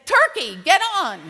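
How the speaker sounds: loud, high holler; calling out. Acted. Tony standing in the parking lot calling guests to dinner, loud holler. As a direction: shout it out to a whole crowd. Calling soldiers onto a bus.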